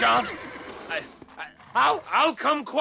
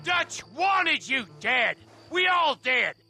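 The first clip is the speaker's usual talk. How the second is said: raised, emphatic accusation; angry retort; 4 semitones higher, 3 dB louder than usual. Acted. Old gang member condemning his former partner, angry and emphatic. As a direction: drive each word home in anger.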